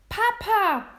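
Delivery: high, loud, alarmed cry; calling out. A narrator's reading. William calls to his threatened father, crying out. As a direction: shout it out high, falling at the end. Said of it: "rief er".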